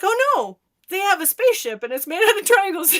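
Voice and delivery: put-on high, loud, fast protesting child; insistent kid self. Kid telling mom about the triangle spaceship, high and insistent.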